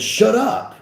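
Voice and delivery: high, loud snap; angry retort. Acted. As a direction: shut them down with a yell. Acted retort at partygoer.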